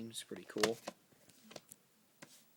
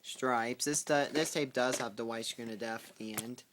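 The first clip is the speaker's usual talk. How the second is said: ordinary talk, nothing marked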